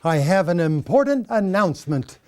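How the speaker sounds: loud, formal statesman address; elder statesman. Acted. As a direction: proclaim it like a secretary of state. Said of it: "the Honorable William Henry Seward".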